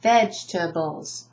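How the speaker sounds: "Vegetables" as VEJ-tuh-bulls, middle E silent; "Vegetables" in three clear beats; three syllables. Shown as a demonstration. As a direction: say "Vegetables" without the second E. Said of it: "I do not underline the E because it's not spoken".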